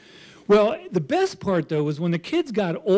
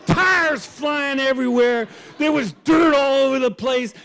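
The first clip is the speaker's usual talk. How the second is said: excited telling; 7 semitones higher, 4 dB louder than usual. Real, not acted. Man describing his crash into tires, excited. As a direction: relish the chaos.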